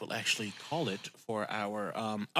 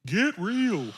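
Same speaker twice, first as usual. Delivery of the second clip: lightly mocking TV-therapist catchphrase; a loud, high burlesque of blunt advice; Doctor Phil; 11 semitones higher, 5 dB louder than usual. Podcaster spoofing a TV therapist's tough love, mock impression. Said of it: "just, like, Doctor Phil-ing all of them".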